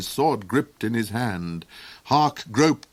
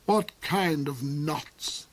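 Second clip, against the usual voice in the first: snarl; 5 semitones higher, 4 dB quieter, much slower than usual. A narrator's reading. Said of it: "he snarled".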